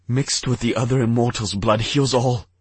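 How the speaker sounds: rising desperate plea; desperate pleading. A narrator's reading. Desperate king.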